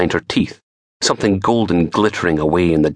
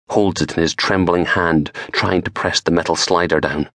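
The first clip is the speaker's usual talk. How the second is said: usual speaking voice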